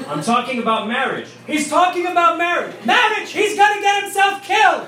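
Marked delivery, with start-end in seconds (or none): 1.4-2.7 high-pitched yell to another room; hollered relay. Retold or imitated. Husband on the phone relaying news to his wife, high holler. 2.8-4.9 very high, loud comic outburst; alarmed shriek. Retold or imitated. Wife in the kitchen hearing the talk's topic, alarmed high shriek.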